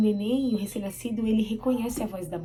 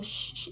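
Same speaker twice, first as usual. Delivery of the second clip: soft, breathy shushing sound; gentle "shh" to settle a newborn; hushing a baby; 11 dB quieter than usual. Retold or imitated. Mother shushing her newborn in hospital, soft hushing. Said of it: "Que aí você falou".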